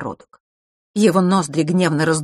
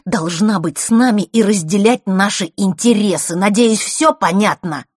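loud, raised, insistent; stern demand; 5 semitones higher, 7 dB louder than usual. A narrator's reading. Brother orders her to stand with the family, insistently. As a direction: lay down the rule forcefully.

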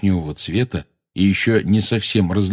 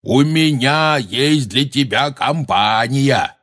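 slow, raised, drawn-out call; 5 semitones higher, slower than usual. A narrator's reading. Hagrid announcing visitors to the giant, shouting. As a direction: holler it up slowly and clearly. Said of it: "крикнул Хагрид".

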